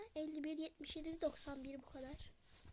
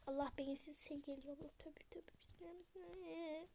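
unvoiced, hushed panic; scared whisper; 4 dB quieter than usual. Real, not acted. Hiding from the monster, frightened whisper.

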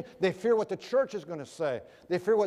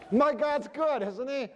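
laughing through a teasing question, higher; laughing tease; 7 semitones higher than usual. Retold or imitated. Preacher needling the flight attendant, laughing and higher. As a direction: gloat with a laugh in the voice.

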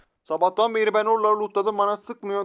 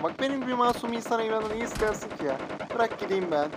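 higher-pitched mock innocent plea; mock pleading; 5 semitones higher, 4 dB quieter than usual. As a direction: play the harmless victim begging to be let go. (masum numarasıyla yalvarır gibi)

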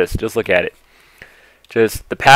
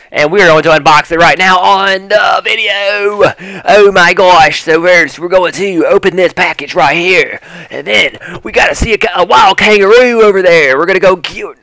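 higher, hammed-up fake Australian; bad Australian accent; 6 semitones higher than usual. Vlogger joking about unboxing and kangaroos, bad Australian accent. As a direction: put on a terrible Australian accent. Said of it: "I'm trying to speak Australian".

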